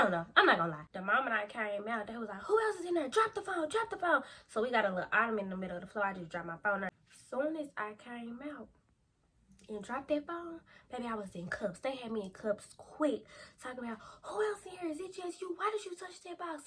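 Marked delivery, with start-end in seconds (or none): 2.4-4.2 high barked police orders; mock officer yelling commands; cop commands. Officers ordering her to drop the phone, barked commands. 14.2-16.7 high, softer mock interrogation; imitating the officers' rapid demands; cop questioning. Officers grilling her about the box, mock interrogation.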